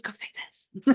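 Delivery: breathy whisper. Shown as a demonstration. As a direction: whisper it eerily. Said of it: "repeats the word whispered".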